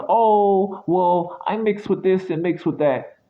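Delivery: high mock voice of a self-important American. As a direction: parody them, up high and sneering. Mocking how Americans talk.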